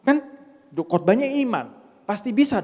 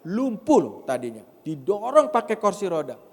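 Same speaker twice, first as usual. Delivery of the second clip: usual speaking voice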